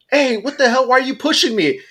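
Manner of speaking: high-pitched indignant protest; offended mom. Retold or imitated. Mom to the man who moved her aside, offended. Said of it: "the mom got offended".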